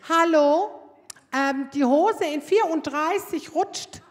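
loud call, slow on the size; yelled across the fitting room. Retold or imitated. Woman in next cubicle yelling to saleswoman, loud call. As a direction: bellow it out to the saleswoman. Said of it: "brüllt eine Frau".

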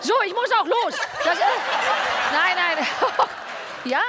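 high and loud through laughter; laughing. Real, not acted. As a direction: laugh while making an excuse. Joking exit, laughing.

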